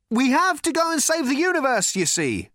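high, loud call. A narrator's reading. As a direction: shout an excuse to the crowd. Ford calling over the wreckage.

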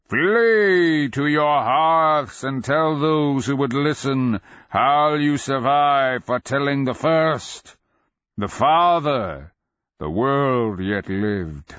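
slow, high, loud dragon warning; booming dragon voice. A narrator's reading. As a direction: command like an ancient undead dragon. Dragon voice held.

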